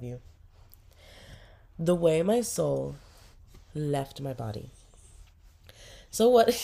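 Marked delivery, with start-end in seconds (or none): 6.1-6.5 louder, higher defiant jab; playful defiance. Real, not acted. Owner defending her crusty-eyed dog, playfully defiant. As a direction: stick up for the dog.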